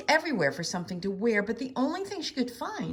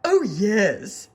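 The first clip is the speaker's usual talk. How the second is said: boastful dragon; 9 dB louder than usual. A narrator's reading.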